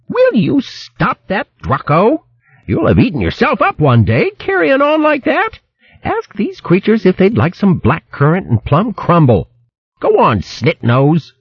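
high, scolding country woman voice; shrill hedgehog wife scolding. Acted. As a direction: berate him like a fierce old hog wife. Merklewort nags Drucko.

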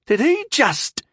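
high, dumbfounded half-question; stunned, pitched right up; incredulous. A narrator's reading. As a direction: break off in disbelief. Captain baffled by boy's challenge.

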